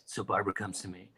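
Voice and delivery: a hint of a smug producer, murmured low. Imitating [name] recounting [name]'s request, low and mild. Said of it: "he's like".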